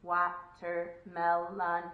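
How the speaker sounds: slow monotone robot saying WA-TER-MEL-ON; four evenly spaced robotic syllables. Shown as a demonstration. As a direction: say Watermelon as a stiff robot. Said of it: "I kinda sounded like a robot there".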